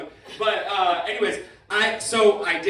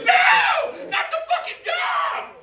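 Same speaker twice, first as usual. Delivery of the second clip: high shouted cry of dismay; shouted protest; slower than usual. Retold or imitated. Moviegoer shouting as the dog dies, high yell. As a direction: yell it like a man in the theater losing it. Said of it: "the man behind me goes".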